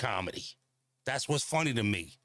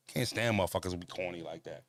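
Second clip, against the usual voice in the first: usual speaking voice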